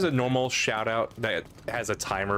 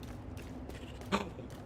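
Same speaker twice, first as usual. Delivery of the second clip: sharp gasp; 12 semitones higher, 15 dB quieter than usual. Real, not acted. Sudden alarm at pet escaping.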